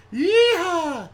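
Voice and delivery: very high, long held yeehaw; playful cowboy whoop. Pretend. Host capping his Southern accent joke, playful whoop. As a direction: let out a loud mock-Southern whoop.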